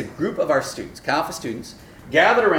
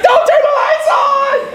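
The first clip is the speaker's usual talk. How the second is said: high, loud, slow laughing shout; a groggy kid yelling at dad to leave the lights off; whining son; 11 dB louder, slower than usual. Retold or imitated. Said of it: "he's like".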